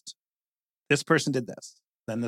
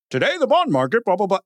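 mock news anchor; 6 semitones higher, 4 dB louder, faster than usual. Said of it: "People were like".

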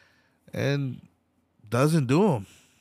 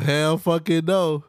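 normal talking voice

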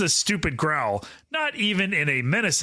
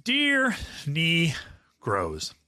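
ordinary delivery

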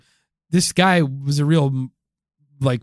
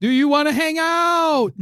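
mock flirt; 13 semitones higher, slower than usual. Flirting with the robot.

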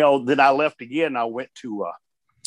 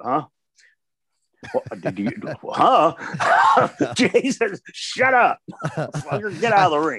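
high, laughing rant at a partner in the ring; mock-yell at him through laughter; laughing mock wrestler; 4 semitones higher than usual. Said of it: "You go".

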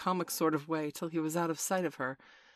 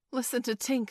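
lightly voiced boy, pitched higher; higher, slightly louder call for attention; Curly; 8 semitones higher, 3 dB louder than usual. A narrator's reading. Curly alerts the others to Tink's crying, lightly voiced and higher. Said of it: "said Curly".

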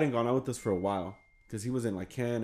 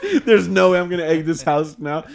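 louder, higher fed-up rant; furious quitting; 5 semitones higher, 8 dB louder than usual. Retold or imitated. Alex quitting the egging after eggs bust, fed-up laughing rant. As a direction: call it off in a rage.

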